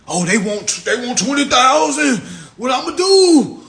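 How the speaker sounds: high, loud mock panic over money; panicked debtor. Mocking a worried debtor in a high, loud panicked wail. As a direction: squeal like a broke man in a panic.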